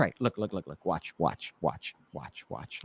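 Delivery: starts plain, sinks to a near hush by the end; fading to a hush. Retold or imitated. Retelling Eric drawing his eye to the trick, fading to a hush. Retold magician draws attention softly.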